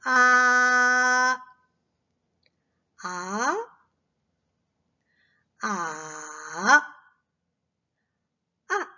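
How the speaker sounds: 아 in four tones, level, rising, dipping, falling. Shown as a demonstration. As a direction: run 아 through all four tones in order. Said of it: "하는 걸 들어봅니다". (1성부터 4성까지 아)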